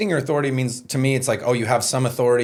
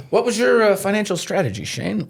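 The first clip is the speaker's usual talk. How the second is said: high, bright mock host voice; mock interviewer; 7 semitones higher than usual. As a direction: play a chirpy interviewer. Mock interviewer asking Shane.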